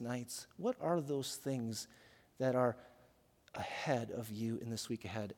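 hushed, low preacher voice; soft and low, inviting them to look inward; quiet guided reflection. Real, not acted. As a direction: gently lead a congregation into reflection. Leads congregation in quiet reflection.

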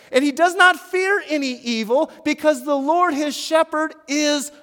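loud, high, slow proclamation; booming preacher, easing slightly at the close; full-force preaching. Real, not acted. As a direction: drive each phrase home to the congregation.

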